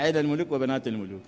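ordinary talk, nothing marked

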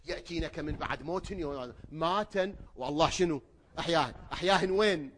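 loud, high preacher's cry; fiery preaching. Acted. Preacher challenging listeners, loud and high. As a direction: drive the question at the crowd.

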